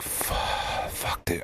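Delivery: trailing, whispered curse under the breath; hushed exasperated swearing. Retold or imitated. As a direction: swear softly to yourself, giving up. Retold exasperated muttered curse.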